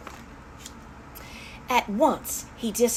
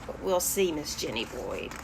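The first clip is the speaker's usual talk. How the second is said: ordinary delivery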